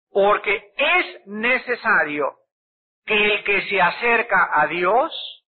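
slow, weighty reading of the verse; slow scripture reading. Acted. Preacher reading Hebrews to the congregation, slow and solemn. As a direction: lay each word of the verse down slowly.

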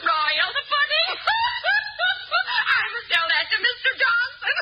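shrieking laughter. Acted. Mrs Johnson laughing at joke.

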